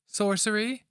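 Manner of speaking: put-on high, questioning young woman. A narrator's reading. Young girl asking about the smell, high and alarmed. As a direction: ask it in wide-eyed alarm.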